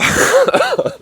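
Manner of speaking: delirious high-pitched giggle. Real, not acted. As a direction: burst into a squeaky laugh. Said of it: "delirious this morning".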